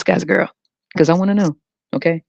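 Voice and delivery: ordinary talk, nothing marked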